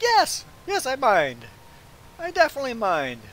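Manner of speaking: high, loud, emphatic comeback; acted-out indignant refusal; retold insistent objection.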